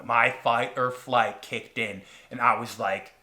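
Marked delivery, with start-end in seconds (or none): none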